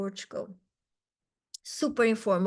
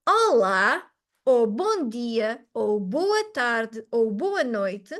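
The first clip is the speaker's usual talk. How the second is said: slow model greetings; slower than usual. Shown as a demonstration. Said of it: "I wanted to share this trick with you".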